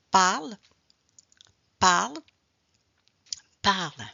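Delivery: "parle" with the final L clearly pronounced; teacher's model "parle", silent E, audible L; final consonant sounded. Shown as a demonstration. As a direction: land on the L at the end of "parle". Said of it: "we always pronounce the last consonant".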